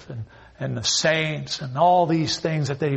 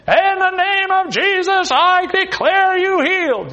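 imitating Benny Hinn; 6 dB louder than usual. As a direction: ham up a TV healer declaring a cure. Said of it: "this Benny Hinn all the time saying".

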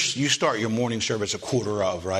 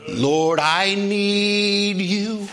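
tender, drawn-out plea; 10 semitones higher, 4 dB louder, much slower than usual. Acted. Preacher's climax prayer.

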